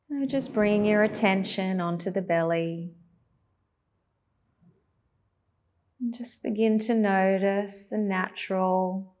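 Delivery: calm, even cues with long pauses; unhurried, guiding attention to the breath; guided meditation. Acted.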